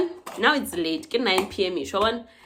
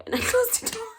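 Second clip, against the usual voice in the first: laughing through the words, much higher; 13 semitones higher than usual. Real, not acted. Telling friends how she shut the door, laughing. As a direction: giggle while telling it. Laughs at her own story.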